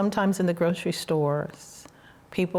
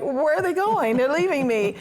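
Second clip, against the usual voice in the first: high, louder mock alarm at being left behind; mock panic; 9 semitones higher, 4 dB louder than usual. Watching friends walk off on the deck, in mock panic. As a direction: squeak it like a deserted kid. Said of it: "I'm like".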